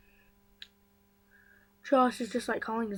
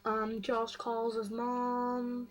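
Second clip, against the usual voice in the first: ordinary delivery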